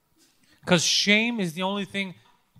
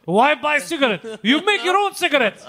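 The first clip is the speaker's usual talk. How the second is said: mock Borat accent; 6 semitones higher, 3 dB louder than usual. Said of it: "looks exactly like Borat".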